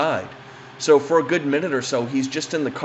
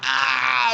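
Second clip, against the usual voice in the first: pained wince; 12 semitones higher than usual. Retold or imitated. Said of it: "she's, like, wincing in pain".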